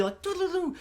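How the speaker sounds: high, soft sing-song doo-doo-doo; tinkly cartoon hearts sound; sound effect. Love hearts floating off the screen, high soft tinkle.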